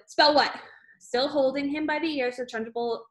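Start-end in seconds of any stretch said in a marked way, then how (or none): 0.1-0.6 higher, louder squealed answer. A narrator's reading. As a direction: squeal it out, pitched up and loud. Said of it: "he squealed".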